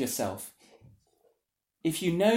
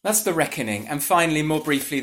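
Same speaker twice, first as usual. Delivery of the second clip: ordinary talk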